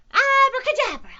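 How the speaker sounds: very high, loud incantation; shouted spell. A narrator's reading. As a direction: cast it at full squeaky volume. Cat casts a spell.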